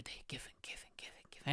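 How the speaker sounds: breathy hushed whisper, trailing away; fading repeated whisper of giving money. Retold or imitated. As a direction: whisper it like a secret habit. Repeated secret saving, hushed.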